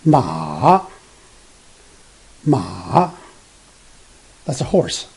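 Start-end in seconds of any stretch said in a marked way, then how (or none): 0.0-3.1 "ma" dips very low, then rises; third tone. Shown as a demonstration. Teacher showing the third Chinese tone, "ma" falling then rising. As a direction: drop "ma" to the bottom and bring it back up. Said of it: "coming down first and then go up later".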